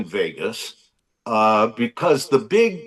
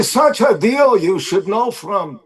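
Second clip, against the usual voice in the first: Jackie Mason accent; 9 semitones higher, 4 dB louder than usual. Shown as a demonstration. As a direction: bark it like an old borscht-belt comic. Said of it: "That's an accent".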